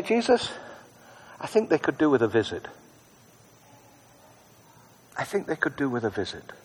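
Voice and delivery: quiet, hushed prayer to Jesus; soft prayer. Retold or imitated. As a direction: murmur it gently like a prayer. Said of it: "He says, "I just say".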